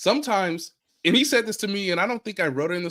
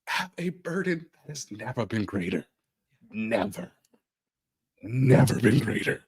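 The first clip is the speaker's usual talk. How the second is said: mock dramatic narrator; 9 semitones lower than usual. Dramatic deep announcer line.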